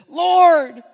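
high, loud pleading cry; impassioned plea. Acted. Preacher voicing Martha crying out to Jesus, loud and high. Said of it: "Martha, his sister, says".

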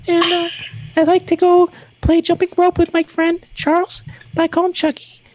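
put-on high kid voice telling a story; little boy voice. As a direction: chatter like a small child. Plays a little boy named Tim.

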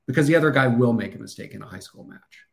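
usual speaking voice

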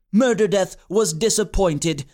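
usual speaking voice

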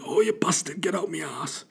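high, strained mock groan; hammed-up straining man on the toilet. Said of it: "He goes".

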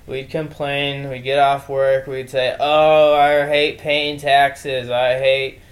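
ordinary talk

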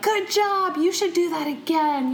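high, gushing mock praise with a laugh at the end; gushing American. Mimicking Americans praising a bad speaker, high and gushing. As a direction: lay it on thick like an overeager cheerleader. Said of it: "Americans will be like".

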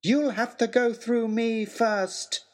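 high, slow, ringing challenge; a youth daring the villain; defiant shout. Acted. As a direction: brave boy shouting defiance. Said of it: "shouted a voice".

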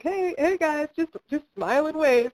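high-pitched, playful imitation of a polite hello; placating mock greeting. Film crew greeting shirtless fans driving through, placating mock greeting. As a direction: humour them in a high voice.

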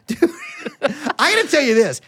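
ordinary talk, nothing marked

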